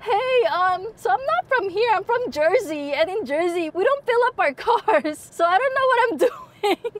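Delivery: high, embarrassed, giggling through it; high flustered plea with giggles; sheepish laughing. Retold or imitated. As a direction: laugh nervously while owning up. Embarrassed asking a stranger.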